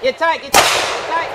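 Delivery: loud, high, urgent push; urging coach. Real, not acted. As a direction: drive the shooter on.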